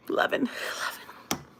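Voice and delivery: slow, breathy whispered loving. Real, not acted. Teasing viewers about romance, slow whisper. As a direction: breathe it out slowly. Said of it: "whispered aside".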